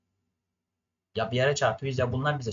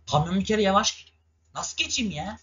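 frustrated high complaint; 7 semitones higher, 4 dB louder than usual. Real, not acted. Frustrated behind a slow truck.

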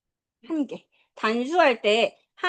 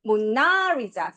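"모나리자"의 "나"에 길게 강세; much slower than usual. Shown as a demonstration. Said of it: "이 번, 두 번째". ("모나리자"의 "나"를 세게 끌어서)

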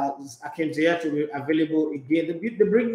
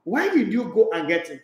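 ordinary talk, nothing marked

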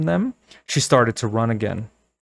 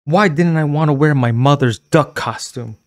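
louder, higher self-reproach; angry; 5 semitones higher, 5 dB louder than usual. Acted. Girl angrily blaming herself for the mask, loud angry. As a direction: scold yourself in anger. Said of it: "she asked herself angrily".

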